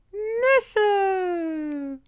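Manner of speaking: very high, drawn-out goblin call; excited goblin cry. A narrator's reading. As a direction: cry out for the nuts. Kobolde rufen aus der Wand. (hoher, lang gezogener Koboldruf)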